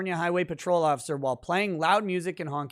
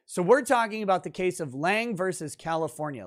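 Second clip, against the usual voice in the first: usual speaking voice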